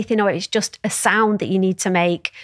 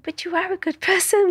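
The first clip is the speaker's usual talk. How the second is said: much higher, small, timid inner voice; little tiny voice; 10 semitones higher than usual. Retold or imitated. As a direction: squeak it out like a tiny hopeful voice. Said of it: "this little tiny voice going".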